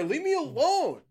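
usual speaking voice

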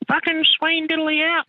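very high falsetto farewell; put-on sign-off. Caller signing off to the boys, silly falsetto flourish. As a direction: squeal a hammed-up sign-off.

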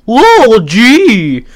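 hammed-up shock at the dinosaur; mock startled. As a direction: jolt of surprise as he looks up. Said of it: "he goes".

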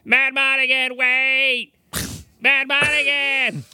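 put-on high, drawn-out accented cry; Willow character impression. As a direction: call out like Warwick Davis in Willow. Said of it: "the accent I heard in my head was".

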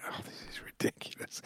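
hushed, breathy whisper. Retold or imitated. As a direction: whisper it under your breath. Player complains after injury.

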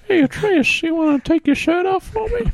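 very high creepy cartoon voice; high-pitched Family Guy creep impression; Mister Herbert. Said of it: "Mister Herbert".

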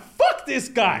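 drawn-out, slow 'fuck this guy'; slow mock outrage. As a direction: let each word land, very slowly. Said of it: "all the girls were like".

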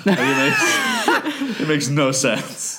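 high, drawn-out words through laughter; real giggling fit, words stretched out; cracking up. Real, not acted. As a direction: laugh helplessly while trying to finish. Cracks up at tiger analogy.

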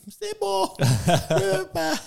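very high, slow whining refusal; mock whine. Refusing to go to late mass, high whine. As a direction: whine it like a kid who won't go. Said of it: "je fais".

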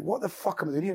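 high-pitched bewildered question; retold alarm, voice pitched high; acted panic. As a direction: relive the panic with your pitch jumping up. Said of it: "I'm, like, panicking".

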